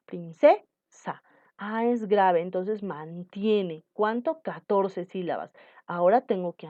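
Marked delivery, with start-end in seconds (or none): 0.0-1.2 Princesa, slowly with the weight on ce; stress on penultimate. Shown as a demonstration. Teacher to student, shows where Princesa's stress falls. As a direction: stretch out Princesa and lean on the ce. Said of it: "mayor fuerza de voz en la ... penúltima sílaba".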